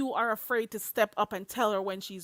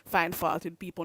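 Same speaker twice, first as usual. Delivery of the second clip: ordinary talk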